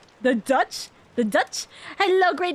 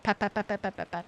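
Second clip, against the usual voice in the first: soft petting; 6 semitones lower, 9 dB quieter, faster than usual. Real, not acted. Petting her model tenderly.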